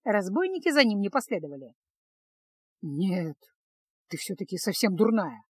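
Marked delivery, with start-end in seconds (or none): 2.8-5.4 low, softer grumble; grumbling. A narrator's reading. Mage scolding the girl after the rescue, grumbling. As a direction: mutter it in annoyance. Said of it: "проворчал маг".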